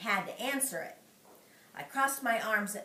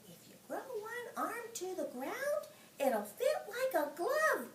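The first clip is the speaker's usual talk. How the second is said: kind of quiet; 10 semitones higher, 5 dB quieter than usual. Acted. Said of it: "I said kind of quiet".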